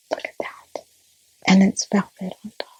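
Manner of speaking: hushed, voiceless whisper; very soft, confiding whisper. Real, not acted. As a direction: whisper it as if in awe. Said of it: "I don't know why I'm whispering".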